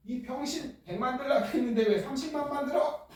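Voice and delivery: high, exasperated rant; hammed-up furious boss; mock angry scolding. Gland scolded for making too little.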